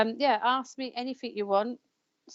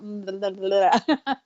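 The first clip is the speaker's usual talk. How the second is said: mock babble; 3 dB louder, slower than usual. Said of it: "I was gonna start".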